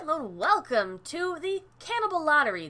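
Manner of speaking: usual speaking voice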